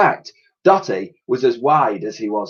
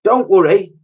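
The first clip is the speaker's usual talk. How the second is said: put-on little girl voice; 9 semitones higher, 6 dB louder than usual.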